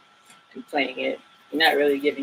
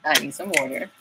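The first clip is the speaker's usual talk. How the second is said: ordinary delivery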